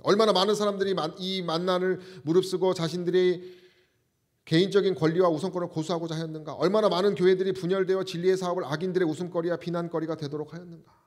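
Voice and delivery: emphatic reading. Real, not acted. Preacher reads an inspired writing to the congregation, emphatic reading. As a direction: read it out with full projection.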